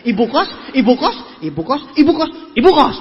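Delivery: each "Ibu kos" stressed differently, last one loud; vary the tune on every "ibu kos", ending punched; varied intonation. Shown as a demonstration. Speaker showing audience five different landladies, varied stress. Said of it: "Beda, beda tekanan intonasinya".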